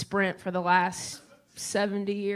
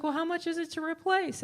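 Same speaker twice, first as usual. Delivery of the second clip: mom voice; 8 semitones higher than usual. Retold or imitated. Mom voiced asking clerk.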